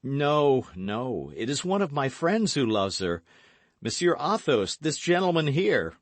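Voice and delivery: loud, high denial; protesting young gallant. A narrator's reading. D'Artagnan protests.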